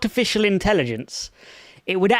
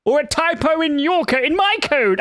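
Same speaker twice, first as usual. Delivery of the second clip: higher indignant outburst; outraged; 8 semitones higher than usual. Real, not acted. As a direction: bristle at the accusation. Outraged at blame.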